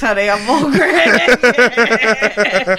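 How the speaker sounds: loud, slow, drawn-out character impression; Old Greg. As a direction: do a hammed-up character voice through laughter. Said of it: "Old Greg or something".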